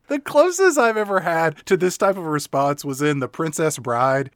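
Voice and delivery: ordinary delivery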